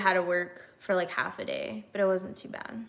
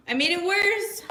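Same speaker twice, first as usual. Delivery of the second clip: dismayed realisation; 9 semitones higher than usual. Real, not acted. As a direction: exclaim it, pitched up in dismay. Realises the mishap got worse.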